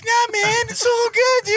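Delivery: nervously smooth things over; mock nervous appeasing. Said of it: "I'd be like".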